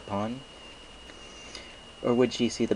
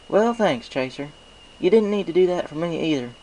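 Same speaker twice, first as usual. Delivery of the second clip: higher, louder country mare; Applejack's voice; 9 semitones higher, 6 dB louder than usual. Acted. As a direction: thank him with plain farm-girl warmth. Applejack thanks Chaser.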